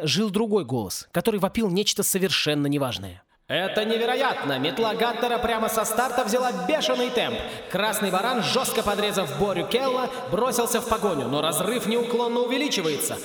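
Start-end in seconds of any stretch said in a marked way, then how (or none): 3.5-13.2 higher, lively sports commentary; race commentator. A narrator's reading. A race commentator shouting over the broadcast, lively play-by-play.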